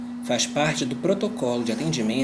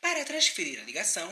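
phone menu voice; 3 semitones higher, 5 dB quieter than usual. Acted. Automated call center phone menu.

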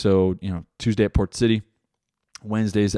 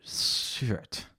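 hissed through clenched teeth; through gritted teeth; 12 dB quieter than usual. Retold or imitated. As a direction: curse under the breath, teeth shut. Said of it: "where you're talking through your teeth".

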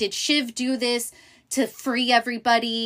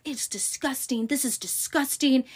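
mock freak-out, higher-pitched; flipping out; 6 semitones higher than usual. Kendall flips out at his sister, mock outraged freak-out. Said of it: "Kendall just flips out".

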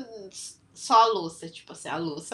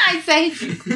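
laughing; 7 semitones higher, 9 dB louder, faster than usual. Real, not acted. Laughing at a bad joke.

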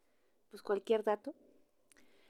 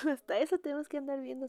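fast, laughing, higher; laughing repeat; 5 semitones higher, much faster than usual. Shown as a demonstration. To the moms in the group, laughing fast.

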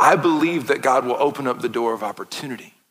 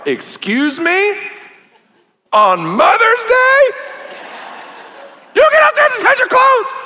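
far higher, louder, slow with laughter; voice up several octaves; 6 dB louder, slower than usual. Retold or imitated. Dad pulling the dad card, shrill high outrage. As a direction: squeak it out in outraged disbelief. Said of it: "My voice went up several octaves".

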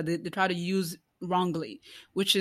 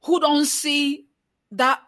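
impassioned preacher, higher and louder; preaching; 5 semitones higher, 3 dB louder than usual. Acted. Challenging Christians who do not see the oppressed, preaching. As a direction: preach it, pressing each question.

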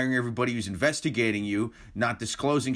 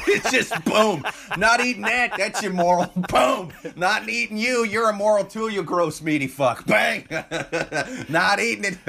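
higher, louder hammed-up hunter laughing through it; mock vegetarian hunter; 8 semitones higher, 4 dB louder than usual. Joking about a vegetarian who shoots deer, laughing hammed-up gunman. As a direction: blast each deer with giddy mock righteousness.

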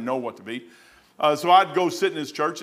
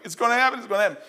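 excited; 8 semitones higher, much faster than usual. Retold or imitated. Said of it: "She's just getting livid".

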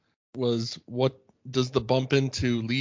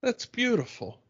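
George admiring; 6 semitones higher, 5 dB quieter than usual. Retold or imitated. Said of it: "George's reaction".

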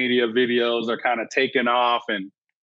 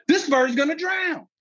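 panicked; 13 semitones higher than usual. Retold or imitated. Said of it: "and I'm freaking out".

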